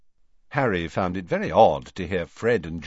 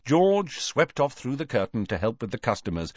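ordinary delivery, nothing marked

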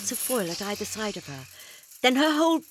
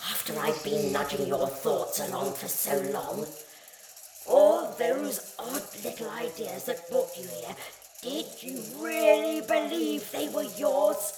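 creeping nightmare whisper; 4 semitones higher than usual. Acted. Alien taunting the doctor.

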